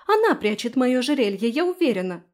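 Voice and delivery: heated outcry; shouted accusation. A narrator's reading. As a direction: accuse her loudly. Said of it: "закричала Фелиция".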